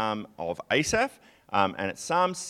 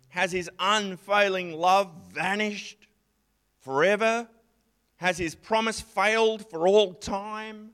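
frustrated lament; 8 semitones higher than usual. Retold or imitated. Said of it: "So now he's frustrated".

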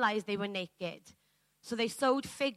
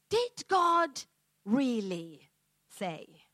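sly serpent voice; much slower than usual. Retold or imitated. Preacher asks the church if they hear the serpent, slow and sly. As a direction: let the doubt creep in slowly. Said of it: "listening to the voice that says".

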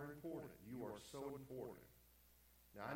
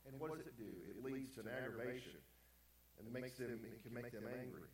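ordinary talk, nothing marked

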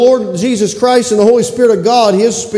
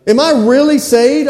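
pleading question; 8 semitones higher, 3 dB louder than usual. Pretend. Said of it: "just ask the Lord".

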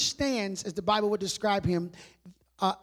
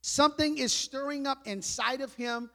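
normal talking voice

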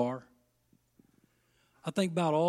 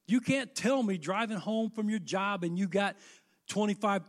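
ordinary talk

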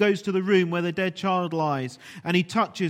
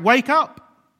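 loud, high rousing call; shout; 7 semitones higher, 8 dB louder than usual. Retold or imitated. Jesus shouting to the dead on the last day. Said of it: "But one day Jesus will shout".

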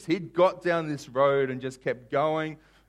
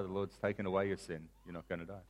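low, very soft pronouncement; quiet pardon; 6 semitones lower, 12 dB quieter than usual. Acted. Nathan answering David's confession, quiet pardon. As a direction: say it low, dropping almost to nothing.

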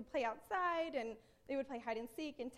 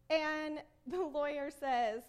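ordinary talk

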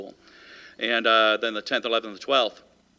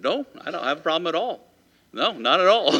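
higher, laughing it off nervously; the bully backing down with a laugh; nervous laughing denial; 5 semitones higher, 4 dB louder than usual. Retold or imitated. Cowed bully answers the tall ninth grader, laughing nervously.

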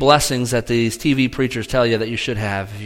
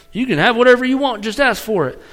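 hammed-up Texas preacher promising the moon; mock Texas TV preacher; 9 semitones higher, faster than usual. Pastor mocking TV preachers' promises in a quick Texas accent. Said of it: "like, a Texas accent when I say that".

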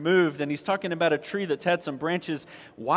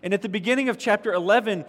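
usual speaking voice

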